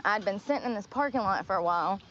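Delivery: normal talking voice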